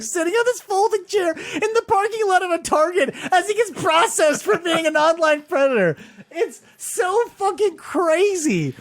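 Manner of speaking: high-pitched, near-laughing disbelief; incredulous amusement. Real, not acted. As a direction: voice climbs high at the absurdity. Incredulous, amused at crying suspect.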